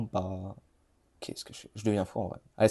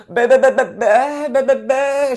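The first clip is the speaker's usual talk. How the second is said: high, loud rhythmic "bah" beatbox; 12 semitones higher, 7 dB louder, slower than usual. Real, not acted. Gamer beatboxing to himself mid-match, high and loud. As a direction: pump out the "bah"s like a beatbox loop. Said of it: "Je fais du beatbox en même temps".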